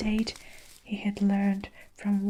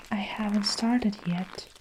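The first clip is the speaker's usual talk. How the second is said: plain reading voice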